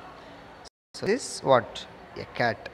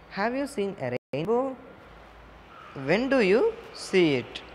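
put-on higher, rising question to a child; coaxing question; 5 semitones higher than usual. Shown as a demonstration. Teacher coaxing a young pupil to answer, high rising question.

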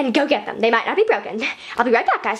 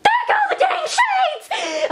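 very high, drawn-out squeal; ear-splitting shriek; much slower than usual. Acted. Vlogger as the shades fall off, shrieking in frustration. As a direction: shriek it slowly at painfully high pitch. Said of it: "that probably really hurt your ears".

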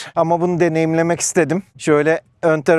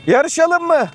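playful challenge; 10 semitones higher than usual. Real, not acted. Teasing ATVs to race.

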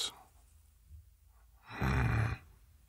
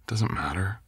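whispering; 5 semitones higher than usual. Acted. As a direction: breathe it gently. Tender reassurance to sick partner.

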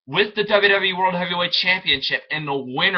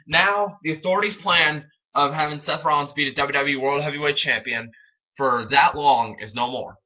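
usual speaking voice

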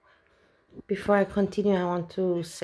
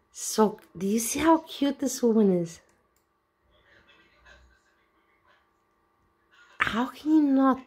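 delighted gushing; 6 semitones higher than usual. Real, not acted. Gushing over Anne's video.

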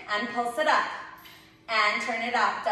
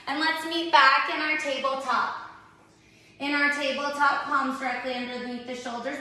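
ordinary talk

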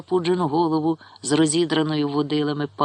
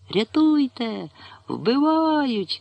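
much higher, slow cry for help; terrified boy screaming; 10 semitones higher, much slower than usual. A narrator's reading. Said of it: "закричав Павло".

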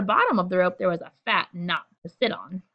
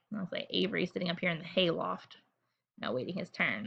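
fast, soft whisper; a reader whispering over a picture book; 2 semitones lower, 8 dB quieter, faster than usual. Real, not acted. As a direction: lean in and whisper it.

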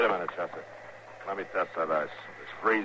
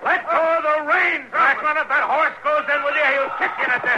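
very high, much louder desperate orders; urgent shouted command; 6 dB louder than usual. Acted. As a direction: scream the warning before the horse drags him under. Marshal yells at man in icy water.

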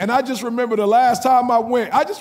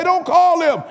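God's voice, shouted; 9 semitones higher, 4 dB louder than usual. Acted. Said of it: "I heard God speak to me and say".

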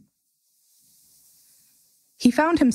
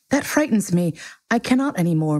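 usual speaking voice

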